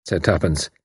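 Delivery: ordinary delivery, nothing marked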